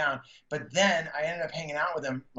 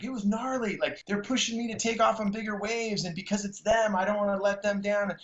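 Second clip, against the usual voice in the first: higher, lightly voiced laid-back surfer; 7 semitones higher than usual. Telling the host how [name] answered, higher and lightly voiced. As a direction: lift the voice a touch for his friend's line.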